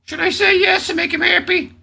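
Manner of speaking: very high mock falsetto; exaggerated girlish head voice; mimicking her thoughts. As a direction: voice her inner debate in a squeaky parody. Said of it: "She was thinking at that moment".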